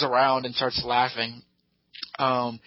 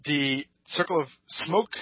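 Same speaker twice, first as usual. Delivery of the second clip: normal talking voice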